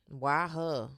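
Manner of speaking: a sulky, envious aside under the breath; mock jealous mutter. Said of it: "And it goes to".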